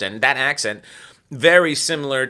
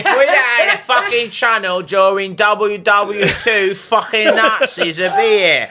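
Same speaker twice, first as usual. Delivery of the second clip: higher, louder hammed-up English bigot; slow ranting pub patriot; English accent rant; 5 semitones higher, 4 dB louder, slower than usual. Joining the bit about British bigots, hammed-up English rant.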